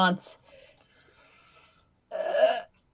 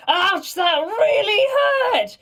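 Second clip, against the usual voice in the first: high, drawn-out wail of protest; loud mock cry of pain; pained shout; 7 dB louder, much slower than usual. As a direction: yell it loud and slow, hammed-up hurt. Player acts character's pained retort.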